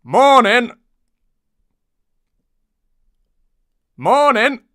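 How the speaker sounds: loud, high-pitched call; high shouted greeting; crying out. Acted. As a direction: cry it out brightly, high and loud. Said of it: "it cries".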